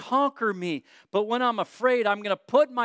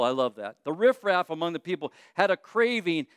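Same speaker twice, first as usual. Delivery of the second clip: ordinary talk, nothing marked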